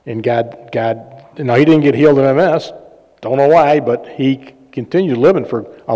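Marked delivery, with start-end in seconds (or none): none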